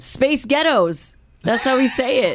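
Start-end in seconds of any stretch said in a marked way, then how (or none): 0.0-1.1 slow, higher-pitched American accent; deliberately heightened American accent; more American accent. Shown as a demonstration. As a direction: lay the American on thicker, slowly. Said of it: "More, more American?".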